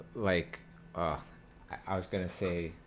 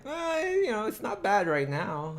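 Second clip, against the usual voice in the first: noncommittal eh; 12 semitones higher, 3 dB louder than usual. Retold or imitated. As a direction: act out a lukewarm so-so reaction. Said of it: "Just the eh".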